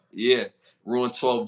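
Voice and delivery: ordinary delivery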